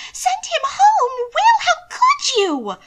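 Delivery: high, loud, slow shocked cry; loud high aghast outburst. A narrator's reading. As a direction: shriek it in horrified dismay. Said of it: "Mina hissed aghast".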